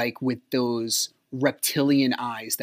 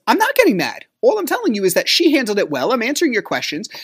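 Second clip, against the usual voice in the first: high-pitched, exasperated self-defense; retold anger; 7 semitones higher, 4 dB louder, faster than usual. Defending himself to the ex in an argument, high exasperated anger. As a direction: protest hotly, pitch climbing as you insist. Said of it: "I'm getting angry".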